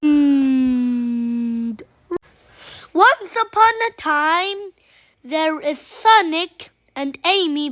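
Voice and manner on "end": very low, drawn-out ending; dramatic the end. Acted. Child ending the story, deep dramatic drop. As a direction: drop way down for the finish.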